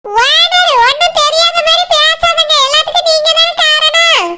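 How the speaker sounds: high, pointed village woman's accusing voice; put-on fish seller voice. Fish seller accusing the owner, high and pointed. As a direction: jab at him as the nosy fish seller.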